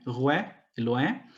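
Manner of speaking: slow "Roé e Loé" with open "wé" endings; "Roé e Loé" with old French oé glide; 14th-century French pronunciation. Shown as a demonstration. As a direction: draw out "Roé" and "Loé" slowly as rwé, lwé. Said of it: "eles passaram a pronunciar Roé".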